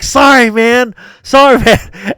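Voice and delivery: high, loud apology breaking into a laugh; mock apology. Player apologizing while beating up his friend, laughing mock apology. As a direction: apologize loudly, barely holding back laughter.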